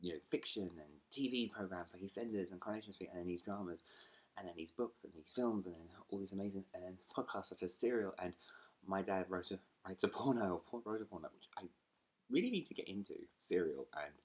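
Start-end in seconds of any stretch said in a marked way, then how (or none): none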